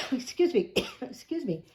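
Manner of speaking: normal talking voice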